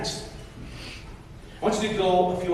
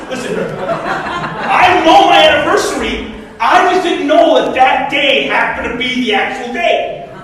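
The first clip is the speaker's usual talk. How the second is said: loud, raised comic self-defence; mock defensive protest; 8 semitones higher, 13 dB louder, slower than usual. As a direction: protest your innocence to your wife, hammed-up. Acts out defending himself to wife.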